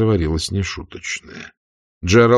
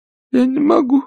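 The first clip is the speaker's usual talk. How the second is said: put-on high feeble old aunt murmur; barely audible babble. A narrator's reading. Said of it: "еле слышно пролепетала".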